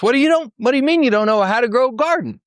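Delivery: high, quick burst of disbelief; incredulous question. Retold or imitated. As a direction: ask it in high-pitched astonishment. Acted disbelief at the students' answer.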